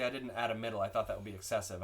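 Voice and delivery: normal talking voice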